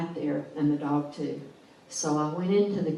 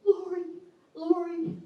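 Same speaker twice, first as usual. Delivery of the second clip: high calls of her name; high, anguished calling out, mildly voiced; retold scream; 13 semitones higher than usual. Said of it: "he's screaming".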